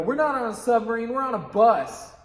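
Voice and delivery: forceful mock objection; acted contradiction. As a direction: flatly overrule your scene partner, loudly. Acted blunt contradiction of scene partner.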